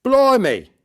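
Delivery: high, loud yelp of shock; startled cry. Acted. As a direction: cry out as if taken aback. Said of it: "he cried".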